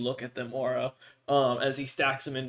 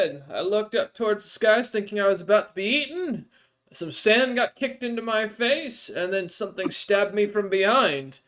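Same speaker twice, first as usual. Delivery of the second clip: NPC voice; 7 semitones higher, 6 dB louder than usual. Acted. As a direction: lift into the odd creature's voice, grateful but hungry.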